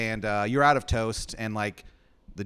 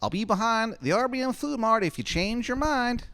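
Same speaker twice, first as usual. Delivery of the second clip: very high, loud, quick falsetto; Shithead Steve asking; 13 semitones higher, 4 dB louder than usual. Acted. Steve asking Adam for a favor, fast high falsetto. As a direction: rush the request up high. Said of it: "He goes".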